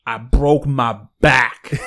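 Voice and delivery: slow, loud Tyson-style quote. Retold or imitated. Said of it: "One of my favorite things from him was".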